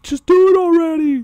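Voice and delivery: very high, loud mock urging; imitated impatient crowd. Onlookers urging the two to fight, mock impatient squeal.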